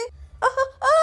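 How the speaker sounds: high gasping "aah"; frightened cry. Acted. Pinky losing her footing on the tree, frightened cry. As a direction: cry out as if slipping. Slipping while climbing down.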